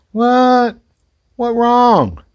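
high, loud, drawn-out call; calling to a puppy. Real, not acted. Owner asking a puppy what's wrong, high loud call. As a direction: call out high and loud, stretching the last word.

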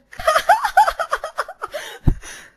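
high shrieking burst of laughter; loud helpless laughter. Real, not acted. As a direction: crack up into a shriek.

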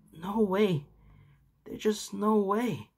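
high, slow, panicked disbelief; half-voiced panic of a boy; hysterical fear. A narrator's reading. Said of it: "he said with hysterical fear".

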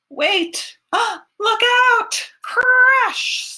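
gasping, high, loud alarm; alarmed shout. Acted. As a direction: cry out in alarm. Something falls, alarm.

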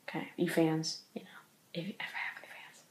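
self-deprecating whispered aside. Real, not acted. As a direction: whisper the joke under your breath. Self-mocking aside to fans.

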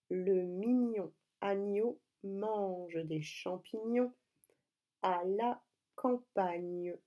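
very slow, syllables stretched out; slow dictation. Shown as a demonstration. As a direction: dictate it word by word for young writers. Repeating the dictation one last time. (dictée très lente et articulée)

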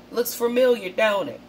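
a playful country accent for the country-folk viewers; light country Southern accent. Shown as a demonstration. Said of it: "Or for my country folks".

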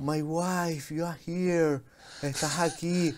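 imitating himself in English. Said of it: "Empezaste a hablar inglés".

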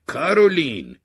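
sudden loud, high shout; involuntary shout of recognition; shouted name. Acted. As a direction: blurt the name out at full volume. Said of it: "دهنم داد زد".

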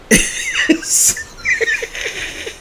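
very high, airy giggle; a breathy, squealing laugh. Real, not acted. Laughs about fake language speakers.

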